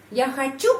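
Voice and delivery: хочу lifted sharply to make a question; question intonation. Shown as a demonstration. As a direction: pitch jumps up on the stressed end of хочу. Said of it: "penekanan di sini, penekanan intonasi".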